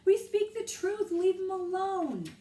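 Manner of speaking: loud, high shout; loud plea. A narrator's reading. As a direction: shout over the crowd. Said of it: "said Annie".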